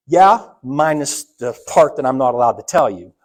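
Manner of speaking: firm refusal. Retold or imitated. Father refusing again.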